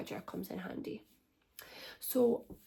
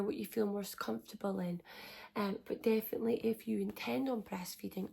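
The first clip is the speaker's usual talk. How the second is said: ordinary talk, nothing marked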